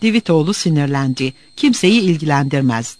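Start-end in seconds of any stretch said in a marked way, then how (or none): none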